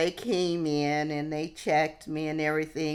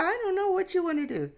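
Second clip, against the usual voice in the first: much higher, very fast, playful; shrugging reply; much faster than usual. Retold or imitated. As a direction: rattle it off high like a shrug. Her reply to friend.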